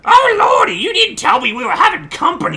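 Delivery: put-on flustered old granny caught with a messy house; old lady voice. Ma greeting her son's surprise guest in a flustered old lady voice. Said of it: "I get to do an old lady voice".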